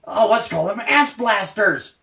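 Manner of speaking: high-pitched mock enthusiasm; hammed-up mock excitement; mocking the namers. Mocks whoever chose the name.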